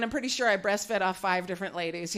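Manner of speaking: ordinary talk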